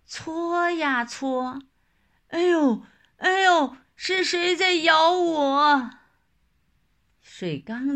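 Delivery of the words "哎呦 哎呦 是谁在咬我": high pained yelp; tiger yelping in pain. Acted. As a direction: cry out as the bitten tiger. Tiger bitten by crab.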